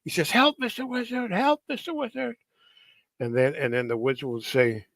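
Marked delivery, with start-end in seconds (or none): 0.3-2.4 cartoon turtle in distress. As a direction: squeal it high like a turtle in trouble. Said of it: "something bad's gonna happen, he says".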